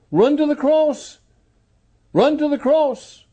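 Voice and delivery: urgent exhortation. Real, not acted. Preacher urging sinners to the cross, high urgent call. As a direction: urge them on, pitched well up.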